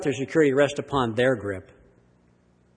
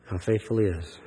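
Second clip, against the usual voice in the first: normal talking voice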